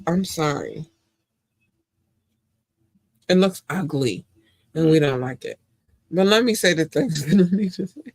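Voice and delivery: ordinary delivery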